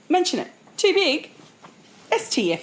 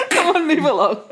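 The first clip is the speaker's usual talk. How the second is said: higher and louder, laughing through it; laughing shoo; 5 semitones higher, 5 dB louder than usual. Retold or imitated. Still laughing, playing the Aussie shooing people along. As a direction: shoo them off while cracking up. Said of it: "and then go, "Move on".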